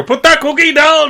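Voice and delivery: loud, high Arnie shout; Arnold Schwarzenegger impression. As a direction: bark it like Arnie. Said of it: "Why do you always do that accent when you shout at me".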